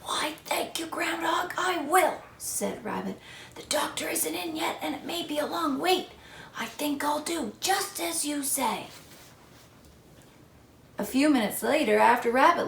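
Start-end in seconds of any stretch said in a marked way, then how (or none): 0.0-6.1 high hushed sore throat whisper. Acted. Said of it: "I have a very sore throat". 6.6-8.9 high hushed whisper with a sore throat. Acted. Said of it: "I have a very sore throat".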